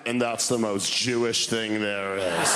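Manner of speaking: put-on slow and dry, like a wry old man; dry grandfather. Grandfather replying to the crying boy, low and slow.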